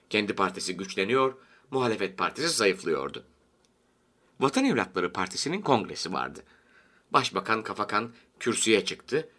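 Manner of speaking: ordinary delivery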